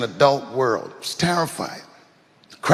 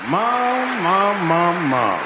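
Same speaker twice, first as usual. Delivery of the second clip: calling mom; 3 semitones higher, much slower than usual. Real, not acted. Addressing his mother in the audience, repeated calls falling. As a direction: call out to mom, fading down.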